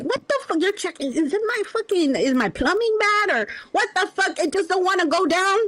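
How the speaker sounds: high, annoyed mock outrage; exasperated at the toilet. Talking to a turd that keeps floating back, high mock disgust. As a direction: act fed up with a turd that won't flush. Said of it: "And you're like".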